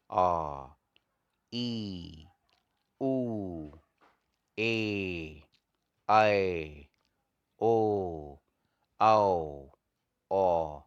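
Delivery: each of "आ, ई, उ, ए, ऐ, ओ, औ, औ" held long and falling; long vowels. Shown as a demonstration. Teacher showing students two-beat vowels, drawn-out long vowels. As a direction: stretch every vowel for two beats, pausing between them. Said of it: "इनको थोड़ा देर तक बोला जाएगा".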